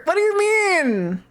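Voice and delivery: slow, high-pitched wail; mock outrage. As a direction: wail it in hammed-up disbelief. Mock outrage about the ads.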